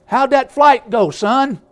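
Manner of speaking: high, loud mock dad voice; papa eagle asking. Father eagle checking on his son after the fall, loud and high. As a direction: call up cheerily to the shaken chick.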